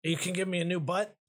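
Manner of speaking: eager and fast, hoping for a new butt; excited Broggedy. Acted. The stone head asks the architect for a new butt, high and quick with eager hope.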